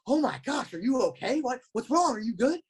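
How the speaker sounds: high-pitched startled worry, imitating his younger self; alarmed concern. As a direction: rush to check he's alright, panicking. Worried kid checking on bleeding Will.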